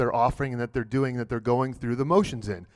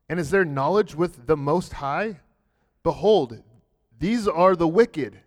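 scripture reading; 3 semitones higher, 3 dB louder than usual. Acted. Reads the psalm aloud.

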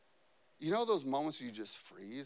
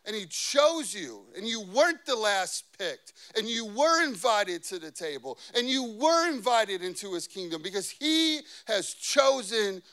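raised pitch, emphatic preacher delivery; impassioned preaching; 3 semitones higher, 6 dB louder, slower than usual. Real, not acted. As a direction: preach it loud, punching the key words. Preaches at full force.

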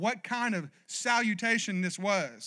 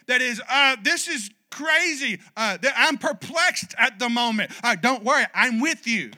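raised, rousing preacher's cry, higher and louder; full-force preaching; 5 semitones higher, 7 dB louder than usual. Acted. Pastor rallies the church to put fear away, preaching at full force. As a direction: drive every line home to the congregation.

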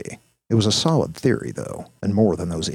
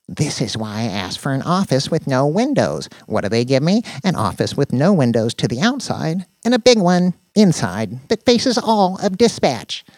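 higher, louder griping tech nerd; exasperated Danny; 8 semitones higher, 5 dB louder than usual. Acted. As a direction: complain with rising exasperation.

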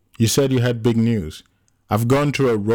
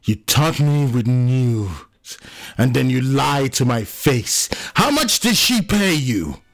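furious accusation climbing to a high yell; angry screaming; 5 semitones higher than usual. A narrator's reading. John accuses Anno of lying, screaming. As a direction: build from drawn-out accusation to a high shout. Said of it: "John screamed, his whole being shaking".

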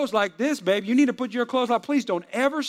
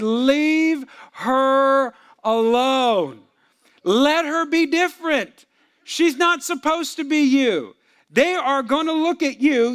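loud, high, slow and emphatic; forceful rebuke; 6 semitones higher, 5 dB louder, slower than usual. Retold or imitated. Pastor ordering husband to accept his wife, slow shouted rebuke. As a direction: hammer each phrase slowly at full voice.

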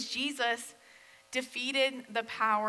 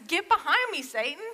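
louder, higher sharp rebuke; stern rebuke; 6 semitones higher, 5 dB louder than usual. Retold or imitated. Jesus rebuking Peter in a sharp, loud voice. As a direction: rebuke him with force.

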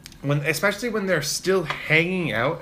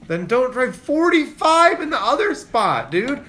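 mock outraged rant; 12 semitones higher, 3 dB louder than usual. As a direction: vent at him like he can hear you. Ranting at the slow driver.

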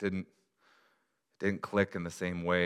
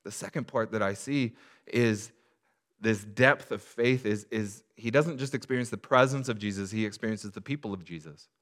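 rising, louder preacher build, slowing on the list; preaching; 5 semitones higher, 5 dB louder than usual. Acted. Pastor preaching to the congregation, building. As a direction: lift it and press each line home.

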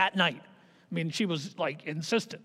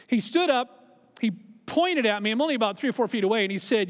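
usual speaking voice

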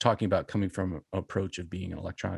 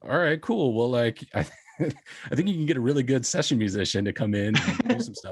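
dismissive; 4 semitones higher than usual. Retold or imitated. Mocking someone in a quoted reply.

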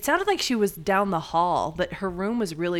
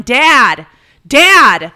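loud, high repeated calling out; patient calling out to her husband, loud yelling; yelling for dad; 5 semitones higher, 16 dB louder than usual. Retold or imitated. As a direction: bellow it down the hall, higher each time. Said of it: "She keeps yelling".

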